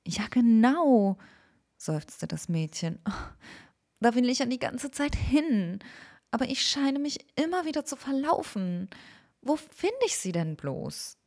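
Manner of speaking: put-on weary girl asking the way.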